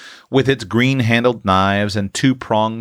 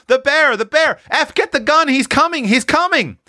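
put-on high-pitched frantic children's cries; panicked youngsters raising the alarm; screaming children; 3 dB louder than usual. A narrator's reading. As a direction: cry the alarm high and urgent. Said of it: "all screaming at once".

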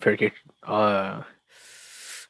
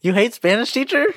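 surprised; 9 semitones higher than usual. Real, not acted. Incredulous echo of friend's answer.

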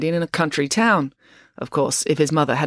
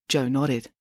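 ordinary delivery

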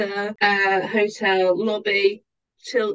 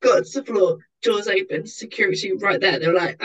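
ordinary talk

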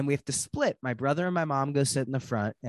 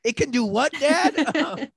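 put-on incredulous kid; 11 semitones higher, 7 dB louder, slower than usual. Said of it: "kid shocked at dad".

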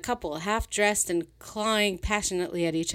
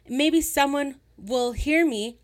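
slow, loud, higher shout with HEAR hit hard; louder angry repeat; 9 semitones higher, 6 dB louder, slower than usual. Acted. Sylvia repeating her threat louder to the men, slow furious yell. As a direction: drag out each word, yelling to be heard.

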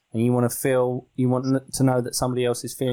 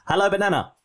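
Perry voice; 6 semitones higher than usual. Acted. Host greets co-host on their podcast in Perry voice. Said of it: "do the Perry voice again".

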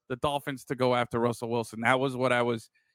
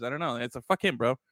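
usual speaking voice

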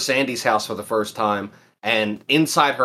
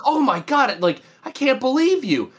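much higher, scandalized cartoon quote; mock shocked; 11 semitones higher than usual. Friend retelling the cartoon onlookers' disbelief, high mock shock. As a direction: gasp it out high, as if appalled. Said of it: "And they look at her like".

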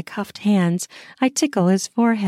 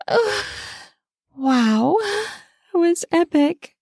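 awed; 10 semitones higher than usual. Acted. Heroine speaks after being speechless.

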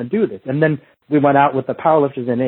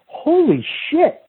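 amazed exclamation; 12 semitones higher, much slower than usual. Retold or imitated. Onlookers amazed by the drill.

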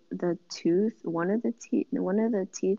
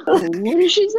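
mock echo; 11 semitones higher, 11 dB louder than usual. Echoing mom's quote laughing.

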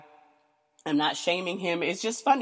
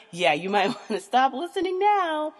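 said through a laugh, pitched up; 9 semitones higher, 7 dB louder than usual. Real, not acted. Laughing as she tells squeamish listeners to stop. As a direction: giggle the warning out. Laughing at her own warning.

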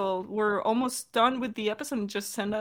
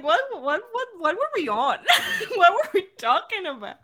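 laughing, bewildered, pitched up; a higher, giggly what were we on; confused laughing; 7 semitones higher than usual. Retold or imitated. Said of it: "and I was like".

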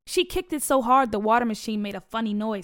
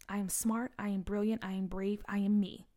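whispered mantra; 8 dB quieter than usual. Acted. Whispered mantra under pressure.

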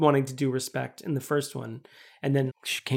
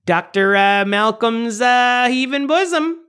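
louder, drawn-out mock Chicago accent; 5 semitones higher, 4 dB louder, slower than usual. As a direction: ham up a Chicagoan, rising in pitch. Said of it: "we haven't done a single Chicago accent".

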